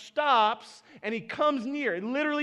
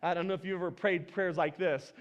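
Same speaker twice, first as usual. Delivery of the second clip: ordinary talk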